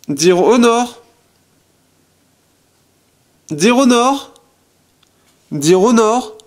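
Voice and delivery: calling the phone loud and high, in English; voice command to the phone. Shown as a demonstration. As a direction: call it out clearly so the phone hears. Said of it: "Alors je vais faire". (commande vocale lancée fort et aiguë au téléphone)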